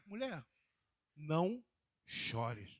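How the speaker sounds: tender comfort. Acted. Jesus comforting the grieving widow.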